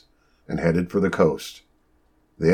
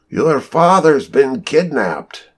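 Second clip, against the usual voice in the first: flustered, higher and slower, blurting it out; embarrassed exclaim; 8 semitones higher, 4 dB louder, slower than usual. A narrator's reading. Nurse tells the girls their father was taken, embarrassed exclaim. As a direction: stammer it out, ashamed to say it. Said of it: "he exclaimed with embarrassment".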